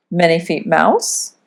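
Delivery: ordinary delivery, nothing marked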